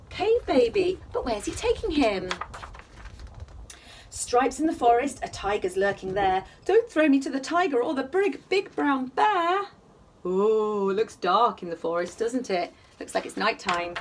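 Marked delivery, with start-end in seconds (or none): 4.1-6.4 gentle sing-song bedtime reading; cosy read-aloud storytelling; story narration. A narrator's reading. Reading picture book aloud. 6.7-9.7 slowing on big brown bear; pleading cave baby. Acted. Baby pleads not to be thrown.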